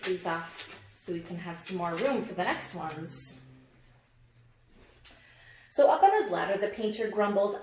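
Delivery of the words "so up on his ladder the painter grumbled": normal talking voice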